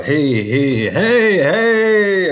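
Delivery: rising high, loud happy "hey"; excited greeting. Retold or imitated. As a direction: call out warmly like spotting a friend. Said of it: "he'll go".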